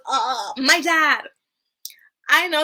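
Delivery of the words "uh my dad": slow, higher, drawn-out answer; mock coached child. Little girl giving her mother the coached answer, mock child voice. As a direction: imitate a kid delivering a planted line.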